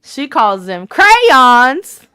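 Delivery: crayons drawn out slowly, cray-ons, Southern style. Retold or imitated. Mimicking how Leah from the South says crayons, drawn out. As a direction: stretch crayons like a Southern Baptist. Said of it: "Leah in the Southern Baptist area".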